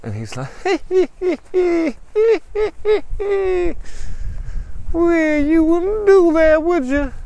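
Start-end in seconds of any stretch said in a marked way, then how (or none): 0.5-4.9 very high staccato cackle; long high "hee-hee" cackle; mocking villain cackle. Villain laughs at drawn gun. 4.9-7.1 very high, loud villain voice; taunt, stretching the last word into a cry; taunting villain father. Acted.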